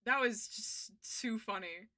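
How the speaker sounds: normal talking voice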